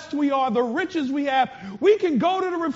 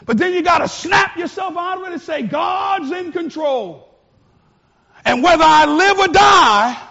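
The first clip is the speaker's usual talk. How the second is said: rallying shout; 6 semitones higher, 6 dB louder than usual. Real, not acted. Preacher rallying against fear.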